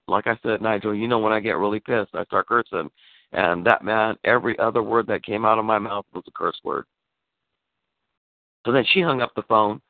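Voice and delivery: ordinary talk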